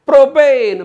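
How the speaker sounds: Propane loud and high, falling at the end; punchy classroom call; shouted drill. Shown as a demonstration. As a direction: belt out propane to lead a chant. Chants alkane against alkyl name.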